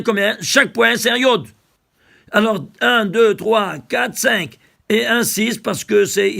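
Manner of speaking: loud, driving sermon voice; rising fervent sermon, counting points aloud; fervent preaching. Acted. Preaching at full force.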